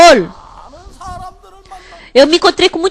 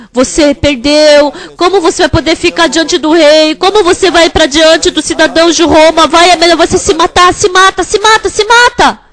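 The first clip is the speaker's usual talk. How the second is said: tempting inner voice; 2 semitones higher than usual. Acted. The evil thought urging Arius to kill himself, taunting and rising.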